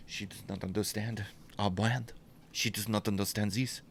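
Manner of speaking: hushed, aggrieved mock French; French accent. Seething maker on a clueless buyer, quiet French accent. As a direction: complain softly in a bad French accent. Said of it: "Awful French accent".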